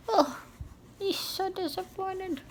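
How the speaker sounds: high, soft, slow pity; sympathetic. Real, not acted. Reader pitying poor Owl to the children, soft and slow. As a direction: sigh it out in pity. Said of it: "pitying Owl to listeners".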